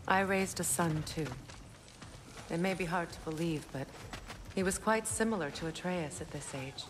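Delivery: soft, wistful reminiscence of a son; quietly reflective, tender and sad; subdued confiding. Acted. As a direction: confide it gently, letting the voice drop. Confides about own lost son.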